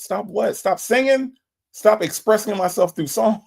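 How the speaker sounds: mock outrage. Viewer told streamer to stop singing.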